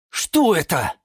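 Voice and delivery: high, eager question; curious question from everyone. Acted. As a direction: ask as a curious little crowd. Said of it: "спросили все". (с любопытством)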